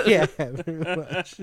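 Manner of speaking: slow words through laughter; laughing. Real, not acted. Laughing at Jesus joke.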